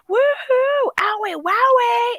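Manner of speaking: very high, drawn-out silly whooping; teasing mock wolf-whoop, way up high; playful catcalling. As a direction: stretch out a goofy high hoot. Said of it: "and we're like".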